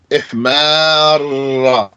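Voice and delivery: Ihmarra drawn out slowly, the long vowel held; stretched intense form. Shown as a demonstration. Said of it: "even more intense".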